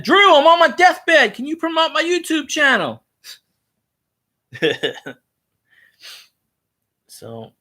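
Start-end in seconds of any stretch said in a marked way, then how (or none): 0.0-3.0 hammed-up dying fan begging a favor; mock deathbed plea. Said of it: "I should have said".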